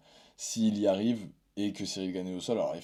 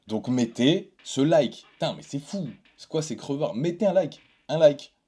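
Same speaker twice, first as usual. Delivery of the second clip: high, loud, put-on outrage at viewers; mock exasperation; 8 semitones higher, 9 dB louder than usual. YouTuber to viewers who still haven't liked the video, mock exasperated. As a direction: scold them loudly, pitch shooting up.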